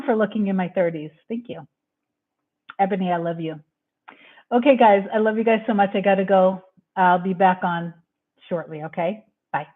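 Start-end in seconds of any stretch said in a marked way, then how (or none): none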